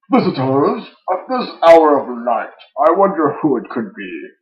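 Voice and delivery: loud, high theatrical villain; needlessly loud villain. Acted. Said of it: "louder than was perhaps needed".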